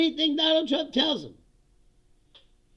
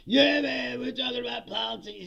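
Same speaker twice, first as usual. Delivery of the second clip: lazy bro voice, starts louder; mock podcaster bro; 6 semitones higher, 4 dB louder than usual. Mocking hooded podcasters who claim to talk politics, bro voice. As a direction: play a chill podcaster in sunglasses. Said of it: "they're like".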